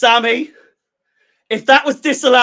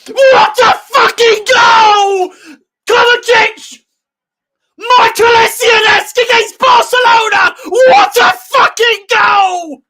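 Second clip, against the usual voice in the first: very high, screamed shouting; ecstatic goal celebration; 12 semitones higher, 7 dB louder than usual. Real, not acted. Fan screaming at his team's wonder goal, real ecstatic shouting. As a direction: scream each phrase out, stretched and at full force.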